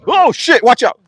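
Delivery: high, loud startled warning; alarmed. Real, not acted. Feeder shouting a warning among the animals, alarmed.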